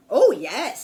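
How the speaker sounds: loud boastful dragon; proud dragon. A narrator's reading. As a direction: boom it out proudly. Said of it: "said the dragon".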